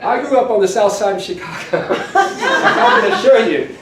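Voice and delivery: laughing through a put-on British RP accent; imitating a Chicago-born man's RP accent, through laughter; mock RP accent. Retold conference quip from O'Neill, in a mock RP accent. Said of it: "speaking in R P accent".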